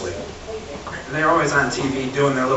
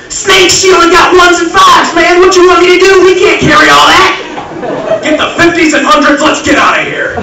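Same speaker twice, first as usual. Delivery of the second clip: frantic high shouting thief; yelling robber; 12 dB louder than usual. Acted. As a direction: shout like a panicking robber mid-heist. Robber partner yelling in heist.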